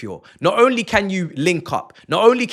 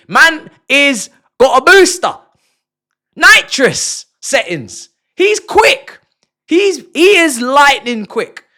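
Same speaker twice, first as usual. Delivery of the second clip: louder, higher, slowed-down hype; excited hype; 8 semitones higher, 7 dB louder, slower than usual. Real, not acted. Fan raving about the new winger, excitedly. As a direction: sell the speed like a hype man.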